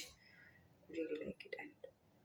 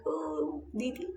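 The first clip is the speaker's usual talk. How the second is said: put-on child calling; 8 semitones higher, slower than usual. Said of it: "these kids are like".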